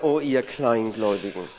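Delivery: low acted reproach; weary reproach. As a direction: sink down in pitch, mildly reproving. Interpreter acts woken Jesus' reproach.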